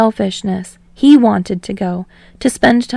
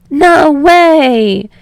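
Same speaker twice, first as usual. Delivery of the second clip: high, loud shriek; high excited shriek; 6 semitones higher, 7 dB louder than usual. Acted. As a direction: squeal in delight. Kaminari shrieks.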